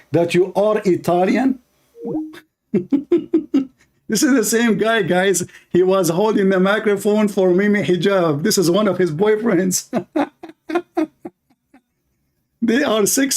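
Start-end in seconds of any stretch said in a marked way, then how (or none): 2.7-3.6 high, chuckling burst of laughter; mocking laugh. Real, not acted. Laughing at the opponent's claim to be Italian, mocking laugh. 9.9-10.5 high, brief giggle; short mocking laugh. Real, not acted. Mocking an opponent to the audience, short laugh.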